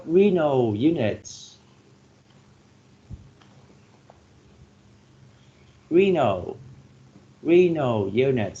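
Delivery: renal with a strong first syllable, RE; stress first syllable. Shown as a demonstration. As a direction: say renal as RE-nal, leaning on RE. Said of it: "stress on the first syllable with renal".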